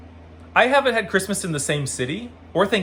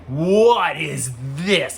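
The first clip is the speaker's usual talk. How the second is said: very slow, wonder-filled question; awestruck child; 3 dB louder, much slower than usual. Retold or imitated. Young fan first watching Annie, slow and amazed. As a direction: draw it out in pure amazement.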